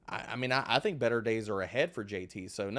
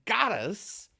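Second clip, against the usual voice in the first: laughing; 9 semitones higher, slower than usual. Real, not acted. Laughing about a bad call.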